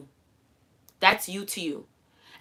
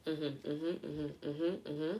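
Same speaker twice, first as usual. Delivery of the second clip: soft, low, smug little hums; calmly agree, as if you saw it coming; quiet knowing hums; knowing mm-hmms; 3 semitones lower, 12 dB quieter, slower than usual. Pretend. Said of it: "and you going".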